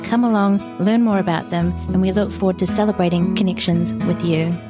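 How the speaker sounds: usual speaking voice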